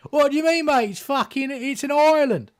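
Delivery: high, loud mock British bloke; a British fella saying island in his thick accent; heavy British accent. British fella insisting it is an island, loud thick accent. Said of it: "British fella with a heavy accent".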